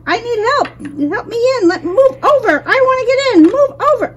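high, urgent cries for help; pleading pig. Pretend. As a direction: beg to be let back in. Pig fell out, begging.

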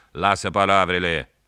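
loud angry bark; furious snap. A narrator's reading. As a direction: cut him off angrily. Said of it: "s-a răstit mai furios polițistul".

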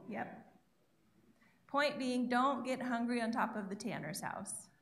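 ordinary delivery

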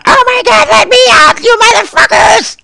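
very high, loud panicked shriek; terrified scream. Acted. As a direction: scream it like a trapped kid. Son screams to escape.

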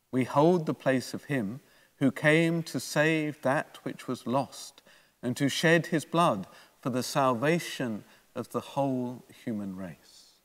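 normal talking voice

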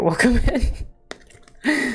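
drawn out. Real, not acted. Greeting raiders, drawn out.

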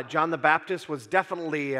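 usual speaking voice

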